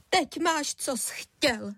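high-pitched, upset accusation; tearful blame, starting high; blurted reproach. A narrator's reading. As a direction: burst out at your husband. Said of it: "vychrlila na něj".

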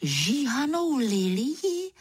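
high, drawn-out astonished question; wide-eyed disbelief, put on; feigned amazement. A narrator's reading. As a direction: stretch it slowly, rising in mock surprise. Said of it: "Žasnu".

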